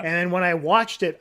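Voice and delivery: normal talking voice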